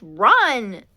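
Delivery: loud yell to run; panicked yell, flee now. Acted. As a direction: Goose yelling at friends to flee. Friends flee the ghost.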